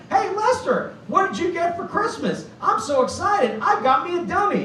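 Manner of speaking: put-on high, chirpy kid act; kid ventriloquist. Kid asks his doll about Christmas, high and chirpy. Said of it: "I'd be like".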